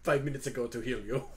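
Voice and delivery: put-on French-accented character waving off thanks; Hugo, gracious and modest. Hugo answers the dragon's thanks, gracious French accent. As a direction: brush off the debt courteously.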